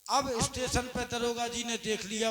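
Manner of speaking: ordinary delivery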